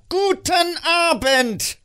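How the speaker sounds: very high, loud, drawn-out yell; exasperated shout. Acted. Scrooge finally shouts the nephew out, high and exasperated. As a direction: blow up and shout him out.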